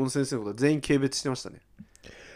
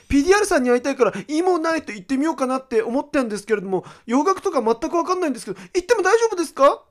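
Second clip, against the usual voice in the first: much higher, put-on listener voice; pleading fan letter read; 11 semitones higher, 4 dB louder than usual. Host reading a listener's tearful question, high pleading voice. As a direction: read it out as an eager, worried fan. Reads listener message in raised voice.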